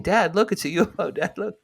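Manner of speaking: put-on be the kid tugging his dad's sleeve; excited little boy. Young boy points his father to the UFO, excited and high.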